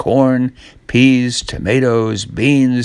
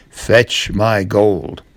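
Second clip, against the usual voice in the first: very slow, lower giant command; giant order; 3 semitones lower, much slower than usual. Acted. Giant ordering his wife to fetch gold, slow and low. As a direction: growl it out slowly.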